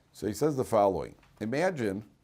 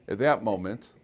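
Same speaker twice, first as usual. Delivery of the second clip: ordinary talk, nothing marked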